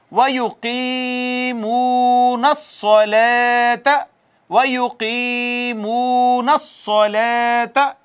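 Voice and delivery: slow, drawn-out chanted recitation; Quran recitation. Shown as a demonstration. Teacher reciting the verse for students, slow chanted recitation. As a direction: recite each word slowly, stretching the vowels. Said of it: "देखिएगा कितना खूबसूरत हो जाता है".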